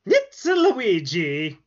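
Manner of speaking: high, slow Italian plumber voice; Luigi voice. Acted. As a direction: stretch it out like Luigi. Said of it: "Kinda sounds like Mario".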